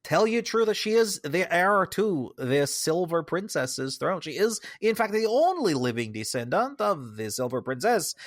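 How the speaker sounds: slow, hammed-up Franco-Swedish accent; Swedish-French hybrid accent. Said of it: "this weird Swedish French hybrid accent".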